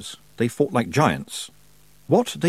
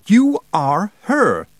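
slow, high, loud insistent shout; 9 semitones higher, 6 dB louder, much slower than usual. A narrator's reading. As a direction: shout each word separately, drawn out. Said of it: "the Wintersmith shouted".

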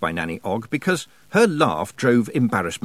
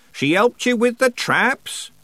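put-on high, slow old man's voice; old countryman; 4 dB louder, slower than usual. A narrator's reading. Old man talks of his traps, slow and high. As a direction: draw it out in an old rustic voice.

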